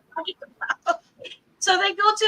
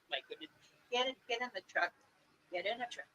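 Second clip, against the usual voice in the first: hushed order; 4 semitones lower, 17 dB quieter, much faster than usual. Retold or imitated. Mom hissing at daughter.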